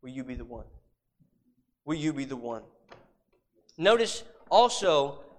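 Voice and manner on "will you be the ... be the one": hushed, low, tender question; loving appeal. Retold or imitated. Preacher voicing God's call to a humble man, loving and hushed. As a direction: ask it softly and slip well below the preaching voice. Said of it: "so faithfully and so lovingly says".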